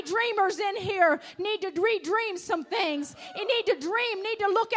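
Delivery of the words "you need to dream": normal talking voice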